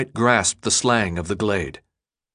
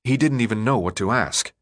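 usual speaking voice

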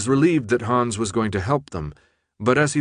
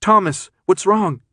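high, loud alarmed demand; urgent yell; 9 semitones higher, 6 dB louder than usual. A narrator's reading. Brenda bending over a speechless Thomas, yelling. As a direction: shout it high and frantic for an answer. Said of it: "Brenda yelled".

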